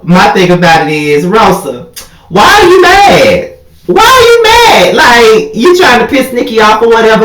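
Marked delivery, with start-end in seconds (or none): 2.3-6.9 high-pitched incredulous squeal; over-the-top exasperated wail; exasperated demand. Acted. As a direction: draw it out high, demanding an answer. Mock confronting Rosa, repeated question.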